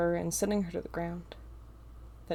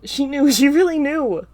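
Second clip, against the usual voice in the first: excited realization; 7 semitones higher, 13 dB louder than usual. A narrator's reading.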